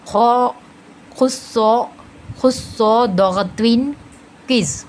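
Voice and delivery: "खुस्सो दगत्विन किस" built up piece by piece; heavy-letter mnemonic. Shown as a demonstration. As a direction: sound "खुस्सो" full and rounded. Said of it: "इस तरह से हम heavy letters को अदा करेंगे".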